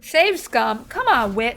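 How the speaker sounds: normal talking voice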